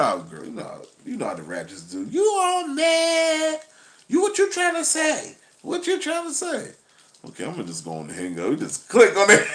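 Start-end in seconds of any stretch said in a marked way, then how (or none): 2.1-6.7 much higher, indignant woman caricature; high, offended woman impression; high mocking woman voice. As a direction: fire it back high and sassy.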